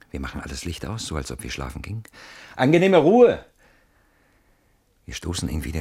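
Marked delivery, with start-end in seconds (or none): 2.5-3.4 loud fake goodnight. Fake goodnight for intruder to hear.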